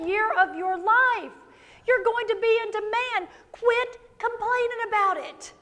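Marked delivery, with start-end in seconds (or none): none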